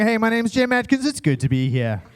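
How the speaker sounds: hammed-up TV news presenter voice; mock newsreader. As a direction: parody of a news broadcast greeting. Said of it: "I watch the news".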